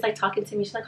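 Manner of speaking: ordinary delivery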